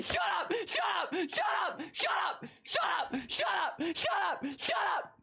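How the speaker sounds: yelled high and sharp; shouting. Real, not acted. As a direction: shout it over and over. Repeated shouted outburst.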